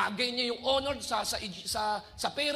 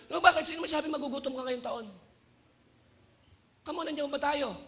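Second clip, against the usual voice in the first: ordinary delivery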